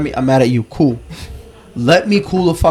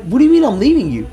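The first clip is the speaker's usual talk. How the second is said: baffled; 9 semitones higher, faster than usual. Retold or imitated. Baffled reply in retold fight.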